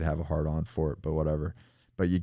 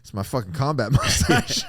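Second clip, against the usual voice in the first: mock defiant line breaking into laughter, louder; laughing; 6 semitones higher, 4 dB louder than usual. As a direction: laugh out the punchline. Laughing at mustache memory.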